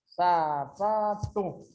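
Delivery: "Sapato" very slow, sa, pa, to; slow teaching split of "Sapato"; syllable by syllable. Shown as a demonstration. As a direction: draw out each syllable of "Sapato". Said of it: "ela tem mais forte aqui".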